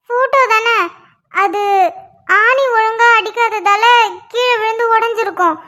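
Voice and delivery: ordinary delivery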